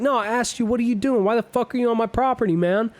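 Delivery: rapid-fire demand, lightly acted; retold angry confrontation. Retold angry homeowner confronting prowlers.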